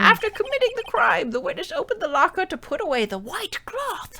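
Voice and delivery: high, theatrical judge. Acted. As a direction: read it as the stern judge. Reads the judge's lines in voice.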